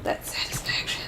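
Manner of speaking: a breathy, mock-dramatic whisper; whispered catchphrase. Repeating his catchphrase to his co-host, in a whisper. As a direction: hush it like a secret.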